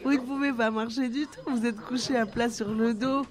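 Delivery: high, merry mock-scolding; joyful exclamation. A narrator's reading. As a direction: exclaim it high, tickled by the absurdity. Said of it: "Sylvie rit joyeusement ... s'écria-t-elle".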